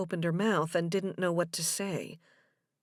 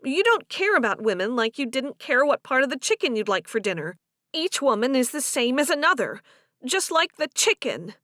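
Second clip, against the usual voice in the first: higher, louder woman making her case; accusing Delia; 4 semitones higher, 4 dB louder than usual. Acted. Delia accuses Joe of not caring which woman, indignant. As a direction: play her driving the accusation home.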